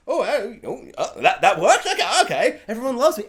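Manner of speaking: giddy laughing Homelander; Homelander relief. Retold or imitated. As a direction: gasp with delight that it worked. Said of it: "Homelander does his".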